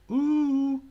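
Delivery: very loud, high, sustained ooh; high whooping ooh. Pretend. Joking about the cartoon chat-up, high whooping ooh. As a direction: let out a playful high whoop. Mock cartoon pick-up routine.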